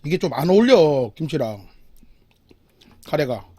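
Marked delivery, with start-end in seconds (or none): 1.2-3.5 whispered aside. Real, not acted. Muttered aside while eating.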